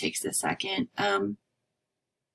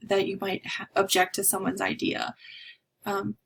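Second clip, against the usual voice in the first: ordinary talk, nothing marked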